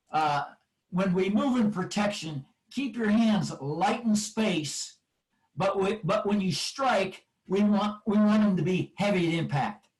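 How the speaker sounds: ordinary talk, nothing marked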